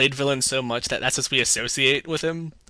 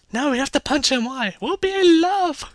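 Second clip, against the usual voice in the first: squeaky, much higher cartoonish voice; silly high mock voice; 13 semitones higher, 5 dB louder than usual. Mocking the movie's lovers in a squeaky high voice. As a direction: play a dim lovestruck character, high and silly.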